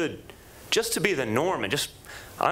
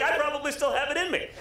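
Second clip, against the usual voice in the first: laughing; 11 semitones higher than usual. Retold or imitated. Retold own remark, laughing.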